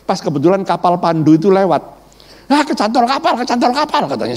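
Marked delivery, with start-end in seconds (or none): 2.4-4.0 much higher jeering call; mocking shout. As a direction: jeer at him across the dock. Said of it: "Semua pada berteriak".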